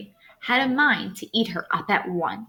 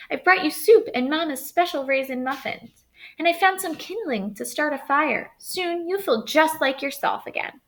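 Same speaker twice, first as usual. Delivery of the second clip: put-on higher, eager girlish voice; little girl; 7 semitones higher than usual. Girl cheers her sick grandmother, eager and higher.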